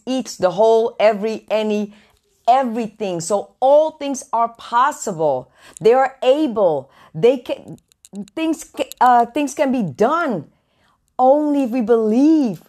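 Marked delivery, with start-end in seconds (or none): none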